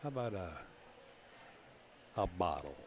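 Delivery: thick New York bottle; New York accent. Shown as a demonstration. Commentator showing off an accent on air, New York accent. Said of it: "strongest New York accent".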